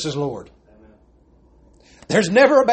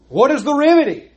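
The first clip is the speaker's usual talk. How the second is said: preaching climax; 8 semitones higher, 5 dB louder than usual. Acted.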